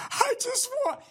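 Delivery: high, soft whimper mocking a crying man; tiny high sobbing whimper. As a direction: whine it like he's about to cry. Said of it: "he's literally crying".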